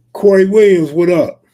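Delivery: shout-out to a viewer in chat; loud greeting. Real, not acted. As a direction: hail him loudly.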